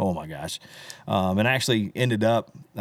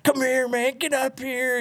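calling out; slower than usual. Retold or imitated. Singer on stage calling the guitarist up, high call. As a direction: call him up much higher. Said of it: "And I'm like".

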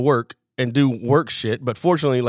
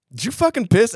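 higher, more pointed questioning; insistent accusation; 10 semitones higher, 3 dB louder than usual. Retold or imitated. Buddy pressing Jim after his blank reply, accusing. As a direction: imitate pressing a suspect for the truth.

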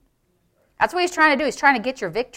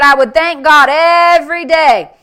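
loud, raised voice stretching "every"; fervent proclamation; 5 semitones higher, 9 dB louder than usual. Retold or imitated. Preacher retells Norval's daily thanks, loudly fervent. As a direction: declare it with preaching fervor.

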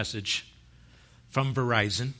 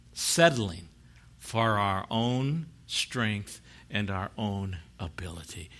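ordinary talk

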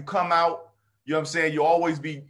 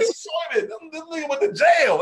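excited gossip; 11 semitones higher than usual. Retold or imitated. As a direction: shout it like you can't believe it. Audience member reacting to jail story.

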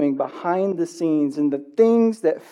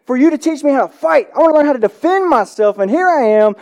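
high, raised-voice mock protest; hammed-up indignant complaint, high in pitch; upset, retold; 6 semitones higher, 3 dB louder than usual. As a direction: act out the frustrated student confronting his teacher. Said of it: "And he gets so upset".

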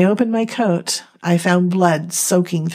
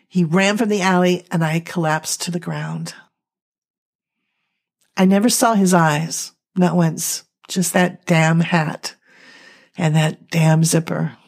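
plain reading voice

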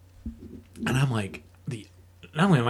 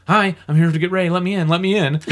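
louder, urgent calling at the door; urgent plea; 6 semitones higher, 7 dB louder than usual. Retold or imitated. Buzzing the door at the home, loud urgent plea. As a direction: press to be let in fast.

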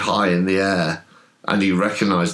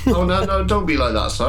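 high, pleading servile voice; placating William; 12 semitones higher, faster than usual. Acted. William begs sir not to be hostile, placating. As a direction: plead gently in a high put-on voice.

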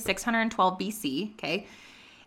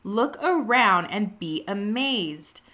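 slow, weighty reading of God's words; slow reverent reading of the Lord's reply; slow solemn scripture read; slower than usual. Shown as a demonstration. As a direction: read it slow and grave, as God speaking. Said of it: "And he says".

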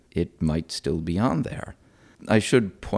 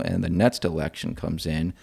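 ordinary delivery

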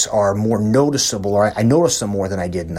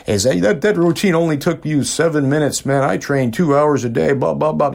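higher, mock-cocky ribbing of his father; teasing the dad; 5 semitones higher than usual. Young son teasing his father about his short workout, higher and cocky. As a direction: needle your dad like a smug young show-off. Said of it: "as a son's obligation is to harass his dad".

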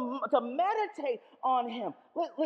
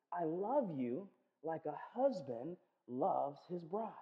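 tender vow; 6 semitones lower, 8 dB quieter, slower than usual. Acted. God's loving promise quoted.

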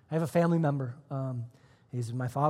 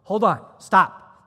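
loud, slow hold on, stop; gruff loud stop; 5 semitones higher, 12 dB louder, slower than usual. Retold or imitated. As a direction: halt him like an aggressive man. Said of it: "He goes".

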